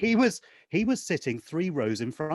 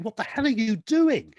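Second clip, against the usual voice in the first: higher, raised-voice disbelief; appalled self-scolding; 7 semitones higher, 4 dB louder than usual. Retold or imitated. Part of me aghast at my own shouting, appalled. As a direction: scold yourself in mock horror.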